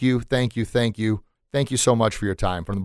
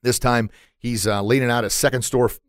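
ordinary talk

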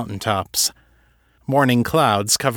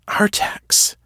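whispered name, dawning recognition; hushed realization. Acted. Kate realizing who the mutual acquaintance is, whispered. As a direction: whisper it as it clicks.

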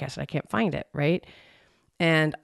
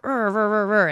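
mock growl of frustration, a bit louder; frustrated growling; 4 semitones higher, 4 dB louder than usual. Growling to herself while hunting for earbuds. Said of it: "And I'm like".

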